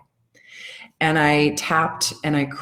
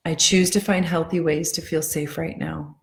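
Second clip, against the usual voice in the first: guided affirmations. Acted. Tapping coach guiding her followers through calming affirmations. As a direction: ease them into calm, one line at a time.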